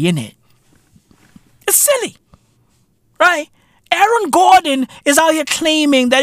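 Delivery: ordinary talk, nothing marked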